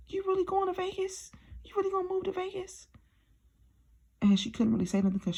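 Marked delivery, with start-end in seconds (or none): 0.1-2.9 much higher, disbelieving question; disbelieving mom. Retold or imitated. Mother calling back to ask about Vegas in high disbelief. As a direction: play mom checking if it's real.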